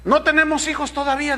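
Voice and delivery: mock-hopeful newlywed; hopeful young wife. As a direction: sound full of hope. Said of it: "dirá alguna madre".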